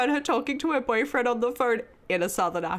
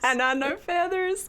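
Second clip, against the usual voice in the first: higher mock Southern echo; Southern accent; 7 semitones higher than usual. Echoing the co-host's movie line, high mock Southern accent. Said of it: "Where the Crawdads Sing Southern accent".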